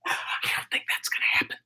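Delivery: quick whispered joke; whispered aside. Real, not acted. Teacher doubting she'll pace herself in Vegas, whispered. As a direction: whisper the doubt fast to yourself. Joking about pacing in Vegas.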